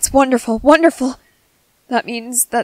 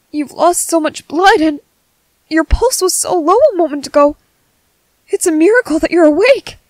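higher-pitched, breaking into marvelling at a miracle; amazed relief; 5 semitones higher, 4 dB louder than usual. Acted. Medic to patient who lost much blood, amazed relief. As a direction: marvel at it in an excited, raised voice.

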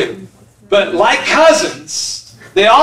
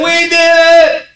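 pleading confession; 6 semitones higher, slower than usual. Retold or imitated. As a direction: beg forgiveness in a higher, drawn-out voice. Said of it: "and said".

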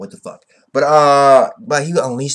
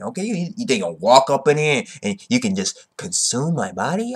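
usual speaking voice

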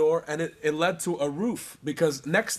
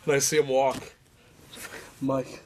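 usual speaking voice

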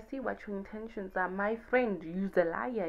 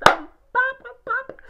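much higher, louder party yell; mock party shout; 14 dB louder, slower than usual. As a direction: burst out like a wild night. Acting out going wild.

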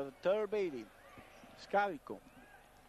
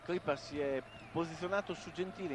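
usual speaking voice

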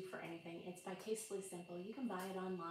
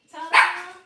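big, very loud ta-da; 9 semitones higher, 24 dB louder than usual. Real, not acted. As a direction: announce the big entrance. Grand entrance back.

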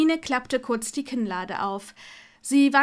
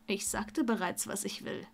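low, hushed, arrogant reply; understated arrogance, dropping away; 3 semitones lower, 8 dB quieter than usual. A narrator's reading. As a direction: say it softly with cool arrogance. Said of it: "sagte er arrogant".

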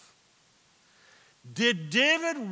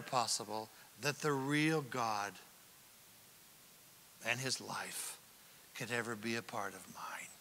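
despairing whisper; 8 semitones lower, 11 dB quieter than usual. Acted.

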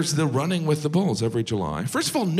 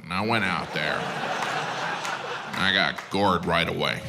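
injured American tourist; 9 semitones lower than usual. Pretend. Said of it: "they interview them".

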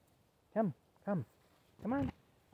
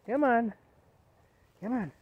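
calling out; 8 semitones higher, 6 dB louder than usual. Real, not acted. Calling dog to come.

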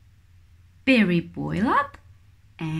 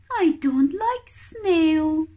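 high little chick voice, pouting; fussy chick; 9 semitones higher, slower than usual. Acted. Baby kiwi refusing weta, high and pouty. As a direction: pout it out like a picky baby bird. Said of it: "says Hooey".

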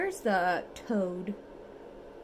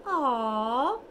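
ordinary delivery

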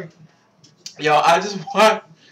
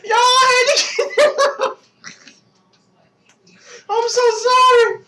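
laughing so hard it nearly turns to crying; shrieking laughter. Real, not acted. Vlogger apologizing to viewers, shrieking with laughter. Losing it over the commercial.

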